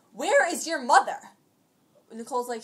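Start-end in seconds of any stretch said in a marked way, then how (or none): 0.1-1.2 loud, raised mock demand; retold yelling. Imitating the lady confronting Nicole, loud mock demand. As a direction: imitate an irate adult demanding an answer. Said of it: "so she's yelling".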